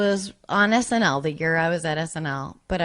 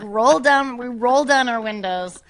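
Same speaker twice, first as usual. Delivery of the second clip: normal talking voice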